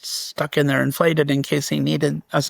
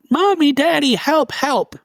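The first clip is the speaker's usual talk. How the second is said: put-on child's shout for help; 10 semitones higher than usual. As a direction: call out like a frightened toddler in the night. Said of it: "I hear my son shout".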